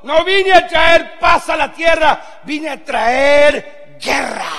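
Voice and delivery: slow, loud, high proclamation; Jesus proclaiming war. Retold or imitated. Jesus warning disciples he brings war, slow loud shout. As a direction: drag each word out like a verdict. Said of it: "les dijo".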